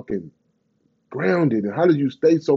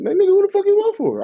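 exasperated squeal; 3 dB louder than usual. Real, not acted. Ranting at people who hide their vote, high exasperated squeal. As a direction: voice shoots far higher in disbelief. Mock asking who you voted.

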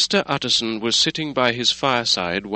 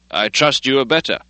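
raised, higher call up to a friend; 5 semitones higher, 6 dB louder than usual. Acted. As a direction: call up, hopeful and loud. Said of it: "he cried".

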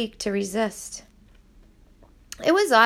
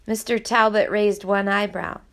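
ordinary delivery

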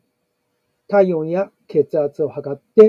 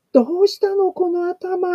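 much higher, surprised nurse voice; nurse's surprise; 13 semitones higher than usual. Retold or imitated. Nurse asking about grandpa's head, high surprised. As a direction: gasp it out like a startled visiting nurse. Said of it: "看護師さんも来るなりですね".

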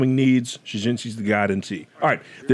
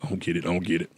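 mimicking the young guys; 6 semitones lower, 7 dB quieter, much faster than usual. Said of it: "they're just like".

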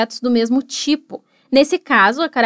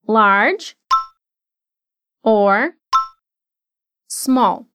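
word by word; 3 semitones lower, much slower than usual. Shown as a demonstration. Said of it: "Repete comigo".

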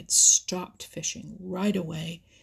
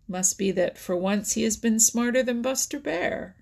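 high, teasing call to the crowd; crying out; 4 semitones higher, 5 dB louder than usual. A narrator's reading. Billy Mink teasing Little Joe before everyone, crying out. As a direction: call everyone's attention, pitched up. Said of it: "cried Billy Mink".

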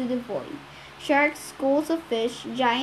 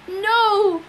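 a loud, high bellowed no; 7 semitones higher, 5 dB louder than usual. A narrator's reading. Tyson refusing the captain's doom, bellowing. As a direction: roar the refusal. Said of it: "Tyson bellowed".

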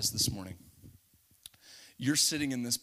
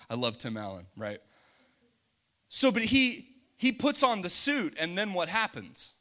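ordinary talk

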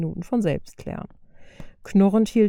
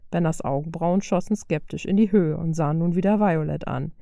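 ordinary delivery

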